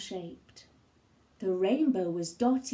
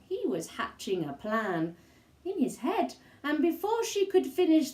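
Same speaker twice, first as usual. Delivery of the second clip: ordinary delivery, nothing marked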